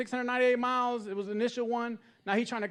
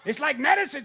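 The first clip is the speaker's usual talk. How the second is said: mock Mexican accent; 6 semitones higher, 8 dB louder than usual. Mexican voice praising cilantro.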